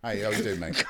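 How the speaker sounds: ordinary talk, nothing marked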